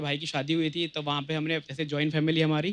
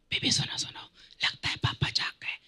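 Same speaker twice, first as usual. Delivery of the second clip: man doing a woman's voice; 12 semitones higher, 3 dB quieter than usual. Retold or imitated. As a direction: hush it like a girl sneaking a call. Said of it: "ये जो आपने ऐसी आवाज निकाली".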